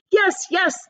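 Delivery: high, eager yes yes; chorused. Acted. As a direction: cheer together. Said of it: "they chorused".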